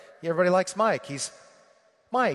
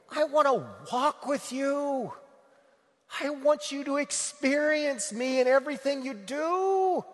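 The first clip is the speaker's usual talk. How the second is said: higher, lightly voiced Jesus, tender and earnest; a hint of Jesus speaking personally, pitched up; 10 semitones higher, 3 dB louder than usual. Preacher voicing Jesus to his listeners, gently pleading.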